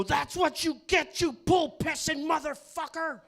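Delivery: high, loud heckling yell, slowed; furious fan shouting from the stands; yelled at the top of lungs. Retold or imitated. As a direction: scream it across the stadium. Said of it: "Top of my lungs".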